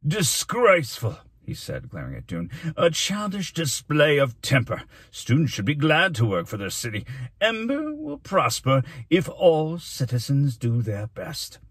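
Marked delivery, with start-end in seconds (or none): 0.1-1.2 high, loud, drawn-out indignation; furious rebuke. A narrator's reading. Mayor glaring at the boy, furious rebuke. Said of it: "blinked furiously". 2.8-11.6 slow, raised pompous lecture; stern scolding. A narrator's reading. Mayor admonishing the whole class, stern scolding. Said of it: "He held up a stern finger".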